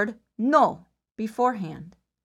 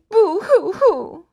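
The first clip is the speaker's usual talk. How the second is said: slow, high, louder mock boo-hoo; fake crying; 7 semitones higher, 6 dB louder, slower than usual. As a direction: ham up a pretend sob. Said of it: "Now pretend to cry".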